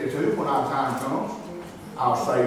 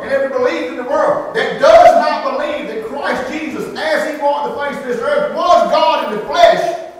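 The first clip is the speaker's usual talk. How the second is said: rising, louder sermon build to a climax; preaching at full force; 6 semitones higher, 7 dB louder than usual. Acted. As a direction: pile up every world and hammer home God in the flesh.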